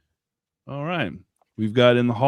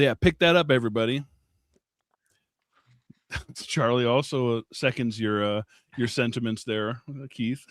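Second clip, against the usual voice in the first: ordinary talk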